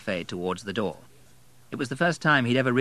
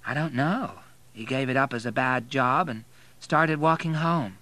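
higher-voiced bar girl; brazen bar girl; 5 semitones higher than usual. A narrator's reading. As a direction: talk frankly as the working girl.

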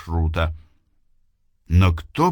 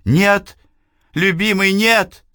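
high, loud desperate cry; shouting; 12 semitones higher, 5 dB louder than usual. Acted. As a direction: scream no at him. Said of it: "кричит Эйвилна". (крик)

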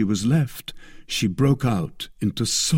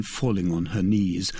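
usual speaking voice

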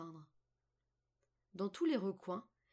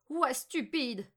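put-on scornful old witch; 4 semitones higher, 7 dB louder, slower than usual. A narrator's reading. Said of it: "dit la vieille".

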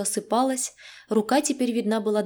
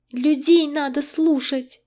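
higher, slow squeak with chattering teeth; frightened squeak; 6 semitones higher, 4 dB louder, slower than usual. A narrator's reading. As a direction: squeak it out while trembling with fear. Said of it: "пискнула она, стуча зубами".

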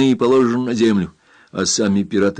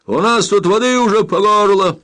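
high, loud, urgent cry; shouted alarm; 11 semitones higher, 6 dB louder than usual. A narrator's reading. Captain warns of rising water, shouting. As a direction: shout the danger through the door. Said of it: "крикнул он".

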